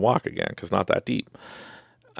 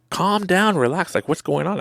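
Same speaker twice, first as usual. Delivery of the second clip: high-pitched, impatient urging; exasperated reassurance; 11 semitones higher than usual. Retold or imitated. Onlooker to panicking swimmers, exasperated and high. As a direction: tell a panicker to settle, voice raised high.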